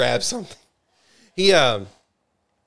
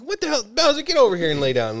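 exasperated; 12 semitones higher, 4 dB louder than usual. Retold or imitated. Exasperated night quote, high.

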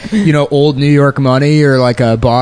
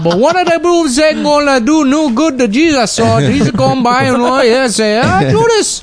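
high-pitched, hammed-up Gullah storytelling; exaggerated Gullah island accent; 12 semitones higher than usual. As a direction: recite scripture in a high mock Gullah accent. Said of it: "translated the New Testament into Gullah".

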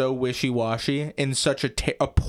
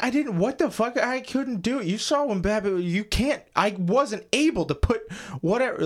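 high, protesting mock voice; Harry Potter; 8 semitones higher than usual. Host voicing the accused hero to a friend, high mock protest. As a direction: plead innocence in a raised pitch.

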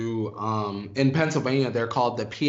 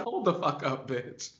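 sassy mock outrage; 3 semitones higher than usual. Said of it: "Felicia said".

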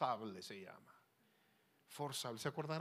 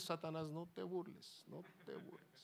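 a softly fading plea; muttered rebuke; 2 semitones higher, 6 dB quieter than usual. Retold or imitated. Preacher reenacts reading the costly fax, quiet muttered rebuke. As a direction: repeat it under the breath, fading away.

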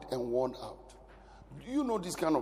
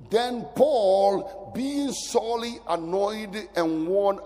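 slow, raised reading of scripture; a lightly raised Bible reading; 5 semitones higher, 5 dB louder, slower than usual. Acted. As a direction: read it out like holy text. Reads Bible passage aloud.

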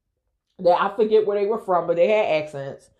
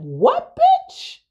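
sudden high, loud shriek on bitch; outraged yell; 5 dB louder than usual. Retold or imitated. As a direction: snap back at him in disbelief, much higher. Confronting Keith about insult.

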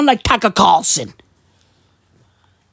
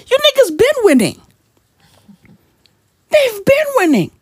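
much higher, outraged comeback; indignant retort; 13 semitones higher than usual. Retold or imitated. Answering [name] about who keeps winning, high indignant retort. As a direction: fire it back in mock outrage. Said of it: "I was like".

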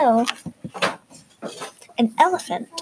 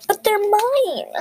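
high, slow, wailing protest; howling; 9 semitones higher, slower than usual. Acted. Girl wailing at the elephant over her toys, high and slow. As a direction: wail it like a child losing her toys. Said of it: "Ellie howled".

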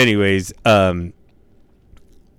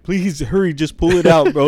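panicked pleading; 9 semitones higher, 5 dB louder than usual. Retold or imitated. Begging nurse during removal.

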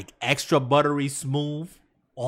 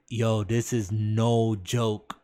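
slow awed quote; 3 semitones lower, much slower than usual. Retold or imitated. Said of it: "I was like".

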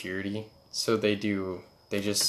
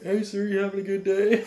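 high-pitched, louder mock-cheerful call; imitated guy on video; 10 semitones higher, 5 dB louder than usual. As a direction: call it out high, absurdly chipper. Mimics guy in combat video.

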